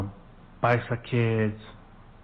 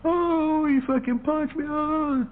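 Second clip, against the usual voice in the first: put-on much higher, whining like a crying kid; mock crybaby wail; much slower than usual. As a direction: imitate a kid bawling after a punch. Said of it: "like a little girl".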